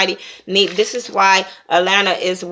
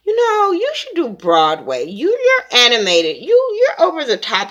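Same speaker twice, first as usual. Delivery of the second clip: higher, sing-song shady praise; mock shady compliment; 7 semitones higher than usual. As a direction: tease her with fake admiration. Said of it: "[name] stopped her and was like".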